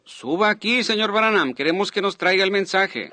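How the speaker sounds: high-pitched public summons; calling him up. Retold or imitated. Man at the microphone summons the preacher to the platform, calling out. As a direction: call a guest up from across a hall. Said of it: "él dijo".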